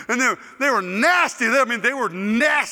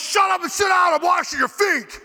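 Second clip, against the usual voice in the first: high, forceful mock yell; bossy shouted order; 9 semitones higher, 4 dB louder than usual. Laurel telling Shane to sit down, acted commanding shout. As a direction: overrule him with a hollered command.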